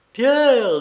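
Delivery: high, loud call of his name; breathless exclamation. A narrator's reading. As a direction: cry out the name in emotion. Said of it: "tout haletante".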